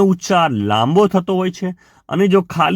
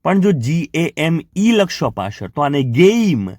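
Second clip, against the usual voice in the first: ordinary talk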